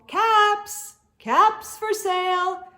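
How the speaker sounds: high, loud singsong peddler call; hawker's singsong call; calling out wares. A narrator's reading. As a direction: cry the wares to the street. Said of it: "he called".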